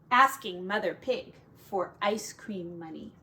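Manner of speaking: plain reading voice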